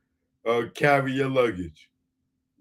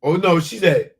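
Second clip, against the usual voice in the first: normal talking voice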